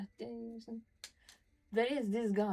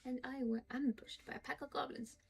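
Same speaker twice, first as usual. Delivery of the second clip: reading a journal entry aloud, a little higher, measured; diary reading; 3 semitones higher, 4 dB quieter than usual. Acted. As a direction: read it like a private diary, steady and serious. Reads Halsin's diary aloud.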